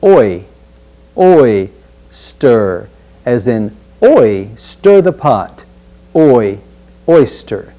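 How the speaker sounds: "oyster" broken into "oi" and "stir"; oyster split up. Shown as a demonstration. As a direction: build "oyster" from "oi" plus "stir". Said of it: "another diphthong, oi".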